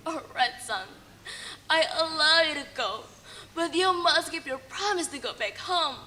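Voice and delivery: soft, quiet giving in; resigned consent. Acted. Mother reluctantly letting her son go, softly resigned. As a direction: let him go quietly, heavy-hearted. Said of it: "with a heart willing".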